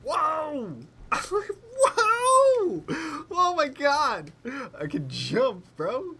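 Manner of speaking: high shrieking whoa with laughter; excited shrieking. Real, not acted. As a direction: squeal with delight. Excited about jumping.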